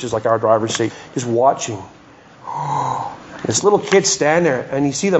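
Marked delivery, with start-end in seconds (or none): 2.5-3.1 quiet retold gasp of shock; hushed gasp; soft gasp. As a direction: catch your breath at the sight. Watching the wave hit.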